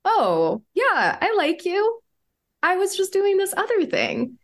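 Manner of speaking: very high, light imitated voice; breezy, high-pitched explaining; easy reassurance. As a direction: play an unguarded, non-defensive partner. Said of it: "and he was like".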